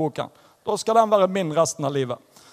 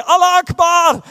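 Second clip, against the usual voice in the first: loud, high, slow religious cry; shouting Allah Akbar; 8 semitones higher, 8 dB louder, slower than usual. Retold or imitated. Retelling the seatmate's cry on the plane, loud shout. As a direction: belt it out like a call to prayer. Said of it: "vet du, så roper de".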